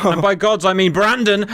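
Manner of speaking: ordinary talk, nothing marked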